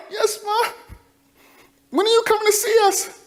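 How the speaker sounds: put-on a child longing for his mom; young boy voice. Child on phone with mother.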